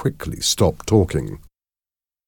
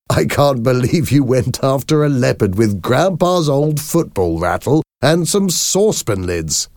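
amused, higher-pitched disbelief; laughing; 8 semitones higher than usual. A narrator's reading. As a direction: say it as if tickled by the story. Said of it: "Simon was laughing".

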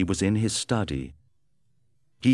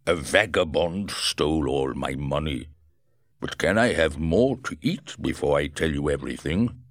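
wounded Griffin; 3 semitones lower than usual. Acted. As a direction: drag the words out in pain. Griffin answers, hungry.

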